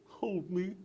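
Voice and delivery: scared robot whispering hold me; whispered plea. Acted. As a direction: beg in a tiny whisper. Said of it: "he would go".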